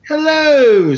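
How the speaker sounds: hammed-up loud hello; mock Costanza greeting. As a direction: belt the hello like a sitcom greeting. Reading fan's email greeting.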